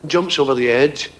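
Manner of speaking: normal talking voice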